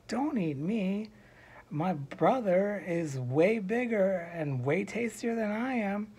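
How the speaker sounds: high, slow, emphatic bargaining plea; pleading medium goat. Acted.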